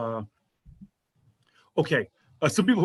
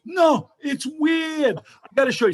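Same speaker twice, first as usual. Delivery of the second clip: high, slow insistent cabbie voice; hammed-up cab driver, much higher and slower; mock excited driver; 11 semitones higher, slower than usual. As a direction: stretch it out high, like a pushy driver. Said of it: "And then he goes".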